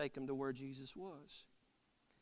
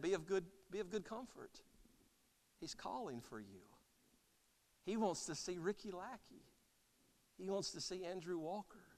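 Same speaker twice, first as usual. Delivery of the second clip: soft, hushed good news; gentle reassurance; 4 semitones higher, 9 dB quieter than usual. Acted. Messengers telling the beggar he is called, gently reassuring. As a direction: comfort them quietly, much softer.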